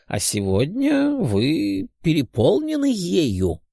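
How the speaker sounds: put-on high, slow, musing old doctor; old man muttering aloud. A narrator's reading. Old doctor puzzled by the patient's energy, muttering aloud. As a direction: ponder aloud in drawn-out old man's tones. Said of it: "громко бормотал старик".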